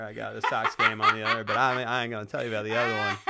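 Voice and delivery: chuckling through the line as the oblivious husband; a teasing, giggly mock of Josh Lucas; laughing Josh Lucas impression. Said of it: "he's like".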